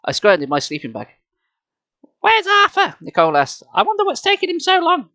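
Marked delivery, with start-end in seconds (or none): 2.2-3.0 put-on much higher, louder girl's voice; a worried young girl, pitched high; Nicole's voice. 3.7-5.1 put-on a worried young girl, pitched high; Nicole's voice.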